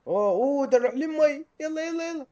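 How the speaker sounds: high, loud dismay; alarmed outcry. Real, not acted. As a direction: call out as you slide to disaster. Heading for the water.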